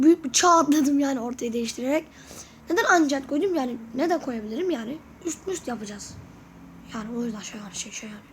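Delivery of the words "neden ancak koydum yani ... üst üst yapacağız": ordinary talk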